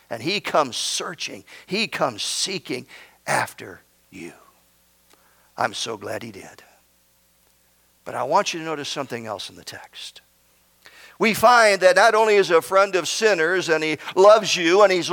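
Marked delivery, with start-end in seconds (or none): 0.1-10.2 low, soft, intimate pulpit voice; hushed preaching. Real, not acted. Preacher tells sinners he comes seeking after you, hushed. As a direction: drop right down low and soft.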